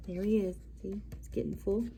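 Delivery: usual speaking voice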